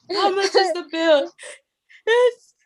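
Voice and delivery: high, laughing back-and-forth over the bill; laughing through it. Retold or imitated. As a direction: retell the call while cracking up. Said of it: "I'm just".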